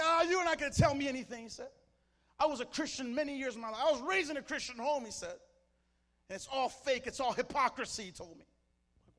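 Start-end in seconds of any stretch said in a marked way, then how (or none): 0.0-1.4 imitating a scoffer, loud and high; mock shouted brush-off, pitched way up; angry retort. Retold angry challenge from scoffer. 2.4-5.0 imitating a loud, indignant scoffer; high, heated retold outburst; angry protest. 6.3-8.0 imitating a scoffer loudly denouncing; high, forceful retold accusation; angry denunciation.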